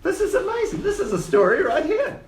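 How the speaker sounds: very high, excited squeal of delight; thrilled gnome. Acted. Gnome finds a story in the mutiny, thrilled high voice. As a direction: gush like a reporter with a scoop.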